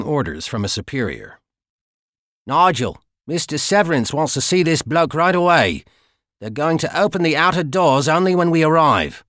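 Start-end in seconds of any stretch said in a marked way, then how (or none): 2.5-9.2 higher, louder Cockney guard voice. Acted. Guard telling Nigel the boss wants the prisoner, in Cockney. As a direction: relay the boss's orders in broad Cockney. Guard relaying orders on radio.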